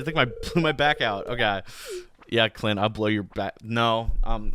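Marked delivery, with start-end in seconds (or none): none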